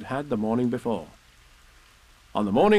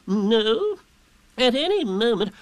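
high, loud owl voice; Owl, pompous; 11 semitones higher, 4 dB louder, slower than usual. A narrator's reading. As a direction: carry on grandly as a learned owl. Owl answers, resumes forecast.

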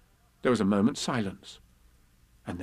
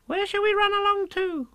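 put-on very high, squeaky child character; little Roo. Roo asks Kanga where to go, tiny high voice. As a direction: pipe the question up high.

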